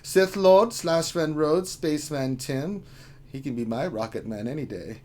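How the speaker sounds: put-on leering, playful German guy accent; hammed-up, flirty German accent. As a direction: read the roll call in a suggestive German accent. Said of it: "as the German guy".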